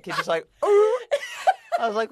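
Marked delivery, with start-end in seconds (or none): 0.6-1.0 shrill mock squeal, laughing; high squealing ooh. Listener imitating the clown from the film, squealing.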